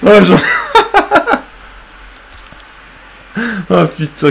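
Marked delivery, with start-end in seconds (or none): 0.4-1.4 high burst of helpless laughter; fou rire aigu. Real, not acted. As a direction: crack up completely. Fou rire après les poteaux. (éclat de rire aigu)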